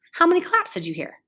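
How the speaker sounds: usual speaking voice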